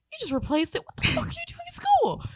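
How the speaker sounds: rising to a very high incredulous squeal; can't believe the mulch in the shoe; hammed-up disbelief; incredulous shriek. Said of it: "You're just like".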